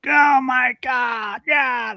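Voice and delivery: put-on high, loud angry old-man shout across the lake; old timer yelling. Old fisherman yelling across the lake at dog owner, high angry shout. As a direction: bellow it from far off like a cranky old fisherman. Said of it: "they start yelling and cussing".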